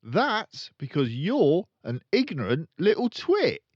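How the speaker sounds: loud, high sneering insult; rude insult. Acted. Father insulting his daughter, loud sneer. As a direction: spit out the insult with contempt. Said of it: "the father said".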